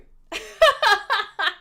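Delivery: loud, high, falling laugh. Real, not acted. Host teasing all the viewers as nerds, loud laughing.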